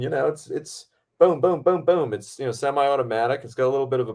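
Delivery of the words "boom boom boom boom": even, higher-pitched run of booms; imitated gunfire. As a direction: boom out a burst of semi-automatic fire. Imitates semi-automatic gunfire.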